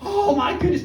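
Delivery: put-on thrilled boy laughing at the valley; laughing excitement. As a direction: giggle out your delight. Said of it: "he was so excited".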